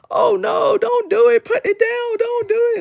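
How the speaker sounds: high-pitched, frantic mock pleading; mock panic. Imitating hostages who plead with her, high mock panic. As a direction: mimic a hysterical hostage, high and urgent. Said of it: "I'm not gonna be like".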